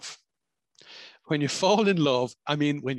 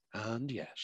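hushed and yet; 10 dB quieter than usual. Shown as a demonstration.

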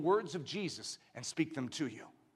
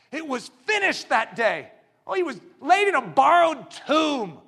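loud, raised pulpit cry; impassioned shouted sermon; full-force preaching; 8 semitones higher, 9 dB louder than usual. Real, not acted. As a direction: belt it out to the congregation.

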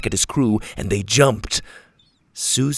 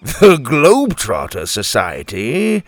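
chuckling; 3 semitones higher, 6 dB louder than usual. Acted. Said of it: "he chuckled".

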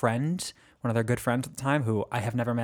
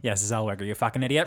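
normal talking voice

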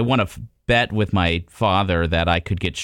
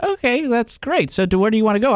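a pitched-up wife who misses the big news; mock unimpressed wife; 12 semitones higher than usual. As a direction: brush it off sweetly, not getting it. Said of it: "She's like".

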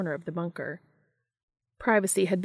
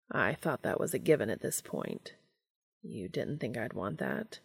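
low, soft, measured character voice; Cass; 6 semitones lower, 7 dB quieter than usual. Acted. Cass answering Dean about staying, low and quiet. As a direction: speak low and hushed, drawing words out.

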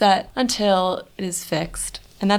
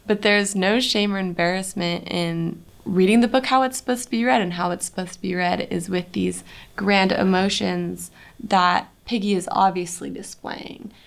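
normal talking voice

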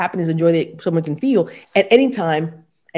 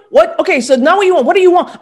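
higher and louder, pressing the question; mimicking the asker; 9 semitones higher, 7 dB louder, faster than usual. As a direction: play a pushy questioner. Mimics a coach pressing a client.

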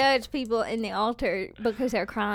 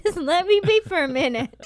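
higher, louder brush-off; mock exasperated plea; 6 semitones higher, 5 dB louder than usual. Praying woman asks to be left alone, mock exasperated. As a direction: plead for space in playful exasperation. Acts out fending off the encourager.

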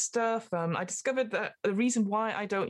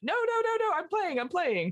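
high frantic protest, easing down; mock panicked plea; 10 semitones higher than usual. Gamer to Xbox shutting down, mock panicked plea. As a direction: beg the machine to stop, hammed-up panic.

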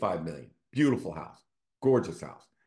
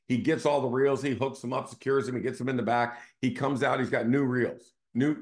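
ordinary delivery

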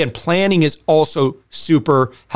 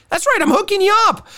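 high, fast and eager; excited; faster than usual. Acted. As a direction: pitch shoots up with hype. Hyping the free gift card.

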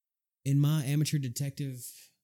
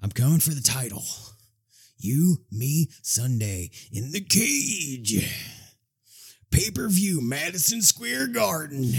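wrestling promo; 3 semitones higher than usual. Pretend. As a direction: bellow it like a heel in the ring. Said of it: "I'm gonna cut a wrestling promo real quick".

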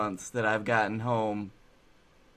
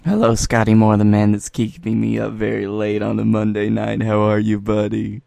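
loud show greeting; 2 semitones lower, 12 dB louder than usual. Pretend.

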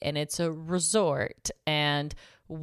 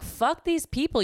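high, slow exasperated outburst; retold exasperation; 10 semitones higher than usual. Guest fed up with resort staff, exasperated. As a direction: vent with pitch way up.

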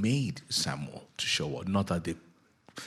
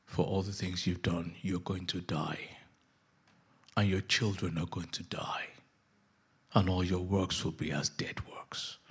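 grave prophet; 3 semitones lower, 7 dB quieter than usual. Acted. Samuel pronounces doom on Saul.

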